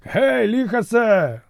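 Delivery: високий протяжний оклик; кличе товариша через натовп; гукає здалеку. A narrator's reading. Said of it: "закричав він комусь із своїх". (фракієць гукає товариша знизу)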